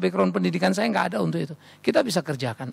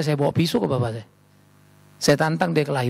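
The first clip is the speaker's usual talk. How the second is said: usual speaking voice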